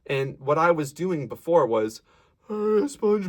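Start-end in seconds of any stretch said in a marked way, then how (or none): 2.5-3.3 high yawny Hey, SpongeBob; yawny sound. Shown as a demonstration. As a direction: keep the yawn in the mouth. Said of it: "I still have this yawny quality".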